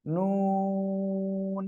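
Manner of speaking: নুন held very long on one steady tone, lips kept round; long drawn-out nun. Shown as a demonstration. Teacher holding নুন so students copy it. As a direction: sing out নুন slowly and evenly. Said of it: "দুই ঠোঁট সব সময় গোল থাকবে".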